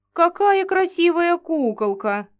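high, slow rapturous giant voice; delighted giant. Acted. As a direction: gush over a doll, giant-size. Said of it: "восторженно пробасил первый великан".

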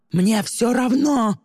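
high, drawn-out bellow; angry yell. A narrator's reading. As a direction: bellow it, stretching the words. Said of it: "заорал Тристин". (яростный ор)

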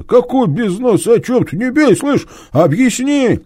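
high, loud panicked pleading; cornered thief begging the inspector; frightened thief. Acted. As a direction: beg him to stop hitting you.

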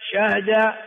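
شهد with sukun on the ha; shortened verb. Shown as a demonstration. Teacher drilling four forms, second "shahda". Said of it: "أربع لغات".

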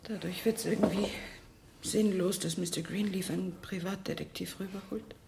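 soft, high, downcast voice; subdued resignation. Acted. Marge accepting Dicky may be dead, subdued. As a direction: say it quietly, as hope drains away.

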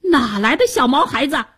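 high-pitched outraged command; furious minister. A narrator's reading. Minister condemning the youth who defied him, furious. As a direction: rage at the upstart, building to an order. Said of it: "白脸宰相闻言大怒".

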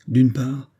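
usual speaking voice

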